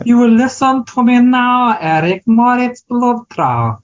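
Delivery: slow, menacing mock German villain accent. Third try at the German accent for his cohost. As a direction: threaten Eric like a movie German villain. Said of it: "Okay, that's better".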